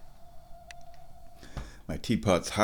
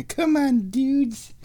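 cat talk; 14 semitones higher, much slower than usual. Real, not acted. Addressing cats playfully.

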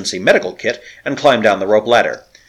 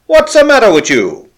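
loud, high hail; shouted call; 12 semitones higher, 8 dB louder than usual. A narrator's reading. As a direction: bellow the question across the water. Shouting across to the ship.

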